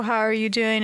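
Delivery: ordinary delivery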